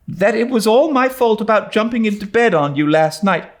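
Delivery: put-on high earnest young girl steeling herself; nervous but determined; Anne, brave girl. A narrator's reading. Anne to Diana, earnest and high.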